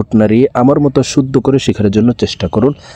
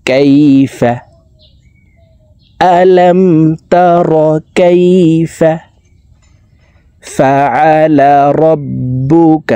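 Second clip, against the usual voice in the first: slow tajweed chant in short repeated phrases; measured chanting for learners to echo; Quran recitation drill; 3 semitones higher, much slower than usual. Shown as a demonstration. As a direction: recite each word with drawn-out vowels.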